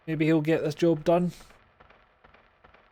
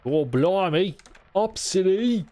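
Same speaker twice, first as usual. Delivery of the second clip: a lightly put-on bad Cockney accent, higher; hammed-up fake Cockney that slips; 5 semitones higher, 3 dB louder, slower than usual. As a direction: toss off a clumsy Cockney exclamation. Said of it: "that was meant to be bad Cockney".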